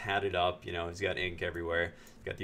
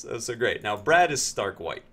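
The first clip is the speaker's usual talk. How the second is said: ordinary talk, nothing marked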